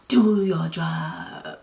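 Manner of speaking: very slow, drawn-out plea; talking to the gas in a very slow plea; slow pleading. Retold or imitated. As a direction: stretch each word out, willing the gas to work. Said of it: "I was literally like".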